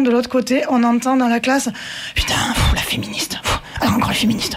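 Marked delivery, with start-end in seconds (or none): 2.1-4.6 imitated disgusted aside, half-whispered; scornful muttering. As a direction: mutter dismissively under your breath. Imitates classroom muttering at feminist girls.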